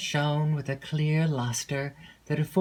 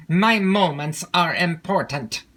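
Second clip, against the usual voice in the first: impatient; 5 dB louder than usual. A narrator's reading.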